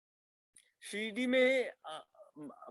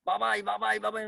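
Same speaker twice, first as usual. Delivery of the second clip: higher, louder cry calling Baba; mother crying out Baba's name on waking, higher and louder; retold cry out; 6 semitones higher, 5 dB louder, faster than usual. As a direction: call out to Baba in a startled cry. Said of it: "चीख रही है".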